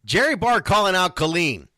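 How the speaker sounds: ordinary talk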